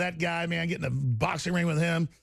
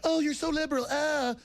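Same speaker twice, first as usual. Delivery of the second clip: mock prissy voice; 10 semitones higher than usual. Mocking people.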